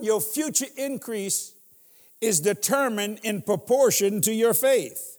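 slow, every word weighed; slow dictation. Shown as a demonstration. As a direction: dictate it so they can write. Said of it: "See, write this down".

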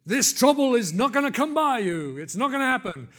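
high, loud imitation of an over-certain prophet; mock prophesying. Well-meaning believer prophesies away someone's trouble, mock proclamation. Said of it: "prophesy and say to somebody".

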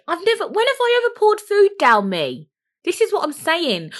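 indignant outburst. Real, not acted. Indignant rebuttal of own worry.

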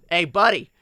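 lightly voiced Midwestern dad; a hint of indignant Midwestern dad. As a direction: call out like a mildly put-out dad. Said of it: "the Midwestern dad voice".

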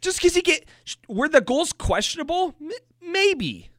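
high-pitched, exasperated concession and comeback; indignant argument. Real, not acted. Host arguing over questionable goals with co-host, exasperated. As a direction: argue it in a high, worked-up voice. Defending the goalie in a rant.

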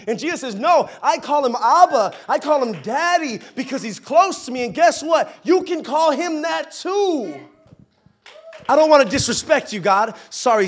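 loud, high, impassioned preacher; full-force preaching. Acted. Preacher urging the congregation to call God Dad, full-force preaching.

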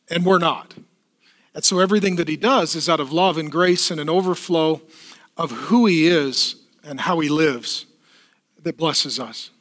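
ordinary talk

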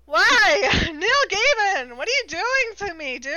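loud, high, hammed-up lament; mock anguished wail. Reader pleading with Neil Gaiman, mock anguished wail. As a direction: cry out at the author in mock despair.

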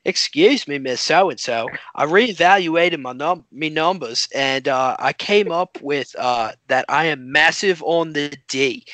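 fuller, hammed-up Aussie accent; imitated Australian classmate. Student reporting her numbers to teacher, broad mock Australian. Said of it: "Wait, let me start over".

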